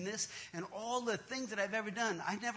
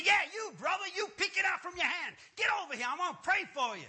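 high, raised voice, mimicking the pastor; pushy preacher's call; 10 semitones higher, faster than usual. Excited pastor singling out a hiding man, hollering.

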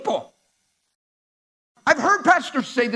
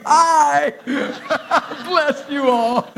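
higher, real laughter through the words; laughing greeting; 5 semitones higher, much slower than usual. Real, not acted. Preacher spotting the overflow crowd, laughing hello.

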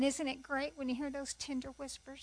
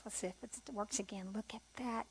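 whispered aside to herself; under her breath, just checking; 3 semitones lower, 10 dB quieter than usual. Real, not acted. Speaker checks her device, whispering to herself.

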